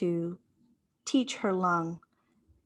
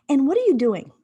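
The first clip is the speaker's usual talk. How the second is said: self-coaching question; 6 semitones higher, 7 dB louder, faster than usual. Retold or imitated. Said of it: "I said".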